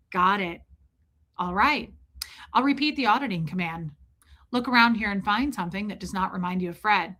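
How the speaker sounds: emotionless, rote auditor script; dead-behind-the-eyes procedural recital; lifeless auditor. Acted. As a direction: carry on as if the grief means nothing. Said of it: "Dead behind the eyes".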